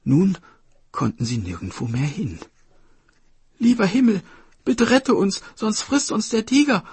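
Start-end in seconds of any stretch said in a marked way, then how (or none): 3.6-6.8 high, loud cry to heaven; desperate high child's plea; pleading sister. A narrator's reading. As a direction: beg for rescue as a frightened little girl. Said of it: "rief die Schwester".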